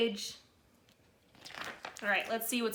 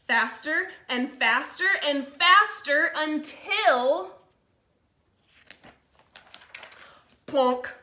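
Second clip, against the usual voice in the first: put-on lively read-aloud to kids; storybook reading; 2 semitones higher, 4 dB louder, slower than usual. A narrator's reading. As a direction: read it like a picture book to the class. Held story narration for children.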